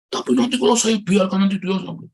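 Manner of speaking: acted agitated pushback; worried objection. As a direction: argue back as an unconvinced father. Father objects again, acted out.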